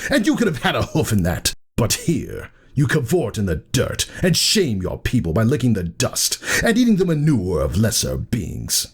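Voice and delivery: high, contemptuous ranting; scornful tirade. A narrator's reading. Thunderhead to his sister, ranting with contempt. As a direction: list her lost riches with scorn.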